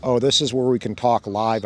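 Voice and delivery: usual speaking voice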